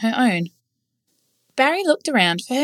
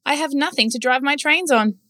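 higher, whiny complaint; complaining train driver; 6 semitones higher than usual. A narrator's reading. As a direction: grumble about the missing track.

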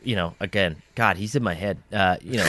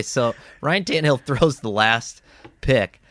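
ordinary talk, nothing marked

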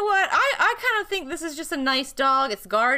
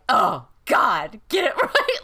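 exasperated; 3 semitones lower, 5 dB louder, slower than usual. Retold or imitated. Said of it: "I'm just like".

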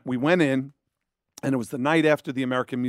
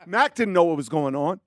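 ordinary talk, nothing marked

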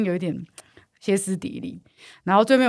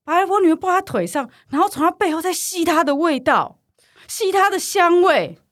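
outraged mother; 7 semitones higher than usual. Retold or imitated. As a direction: accuse the girl with mounting outrage. Hysterical mother accusing daughter. (高声激动地控诉)

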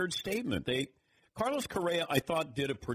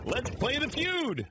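high, drawn-out game show call; gleeful announcement; 10 semitones higher, slower than usual. Real, not acted. Gleefully kicking off a baseball feud.

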